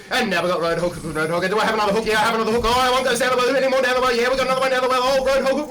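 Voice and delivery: fast, rising auctioneer patter. Pretend. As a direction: rattle it off like an auctioneer. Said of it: "Let's try and do this auctioneer style".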